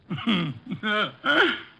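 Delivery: amused chuckle. Acted. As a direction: laugh at the simple answer. Wolfe laughs before asking fee.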